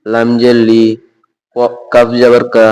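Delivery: ordinary talk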